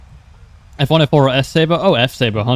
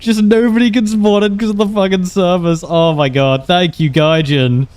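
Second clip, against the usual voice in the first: laughing disbelief, high and loud at first; amused exasperation; 7 semitones higher, 4 dB louder than usual. Real, not acted. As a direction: crack up over a ridiculous failure, voice raised. Laughing at servers failing, mock thanks.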